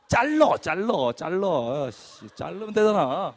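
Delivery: loud, slow, laughing mock shout; half-laughing, hammer out each word; defiant shout. Retelling daring the boss to fire him, loud mock shout.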